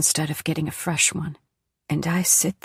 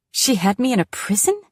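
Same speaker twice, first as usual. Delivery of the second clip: raised and louder, shocked disbelief; blurted in shock; 6 semitones higher, 5 dB louder than usual. A narrator's reading. Daughter hearing of her prison birth, stunned blurt. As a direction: it bursts out before she can stop it. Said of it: "I blurt".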